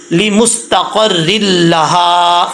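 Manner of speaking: لمستقر لہا drawn out very slowly; slow recitation. Shown as a demonstration. Teacher recites slowly for pupils to repeat. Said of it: "لام زیر لی".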